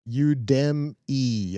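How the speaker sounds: Udemy slowly stretched as you-DEM-ee; drawn-out pronunciation. Shown as a demonstration. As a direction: sound out Udemy in slow pieces, you-DEM-ee. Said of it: "I think I was doing it right".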